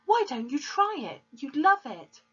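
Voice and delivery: put-on high, loud girl's eager plea; earnest young girl defending her book; pleading girl. A narrator's reading. Daughter pleading with her father, high and eager.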